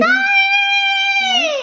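very high squeaky "Hi". Real, not acted. Cooing at baby.

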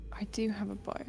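whisper. Real, not acted. Player aside realizing.

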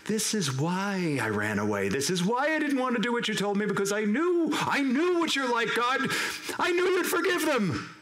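acted fury, pitch rising steeply; Jonah's angry complaint. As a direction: build to a high, indignant outburst at God. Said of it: "Jonah is angry".

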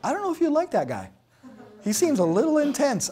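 teasing mock question.

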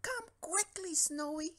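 high urgent yell for a friend. A narrator's reading. Stanley yells for his friend, high and urgent. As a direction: shout upstairs for help. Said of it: "yelled Stanley".

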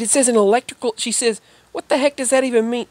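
baffled cashier. Retold or imitated. Cashier's baffled outburst retold.

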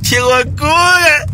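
high, slow thrilled shout; excited shout. Real, not acted. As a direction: yell it out in disbelief. Thrill of the crazy drive.

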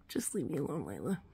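low, soft, weary grumble for the cat; grumpy cat voice. Retold or imitated. Owner voicing Taz telling Lila to leave him alone, in a low grumble. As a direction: drop the pitch and sound fed up. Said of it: "He's like".

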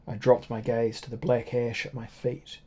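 usual speaking voice

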